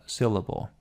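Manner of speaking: ordinary delivery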